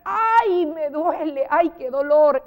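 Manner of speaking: high, loud cry of "ay"; mock ouch, punched loud; exclaiming in pain. Shown as a demonstration. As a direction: demonstrate a pained outcry, acted. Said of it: "que exclama dolor".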